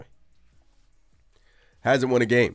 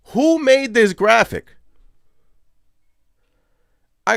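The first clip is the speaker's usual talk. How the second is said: loud, high, incredulous rant; 11 semitones higher, 7 dB louder than usual. Real, not acted. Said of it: "that was my little rant".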